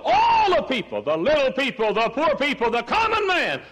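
soaring high, ringing preacher's cry, slowing on the last words; preaching at full force. Acted. Preacher rising to full force before his congregation. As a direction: lift the congregation up with a preaching peak.

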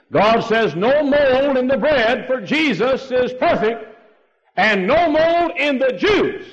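high, loud, slow pulpit cry; fiery preaching. Acted. Preaching at full force.

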